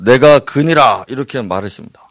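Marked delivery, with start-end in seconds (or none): 0.0-1.0 loud, slow, commanding declaration; bold, majestic Jesus. Acted. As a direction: step forward and proclaim it with authority. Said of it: "당당히 앞에 나가셔서".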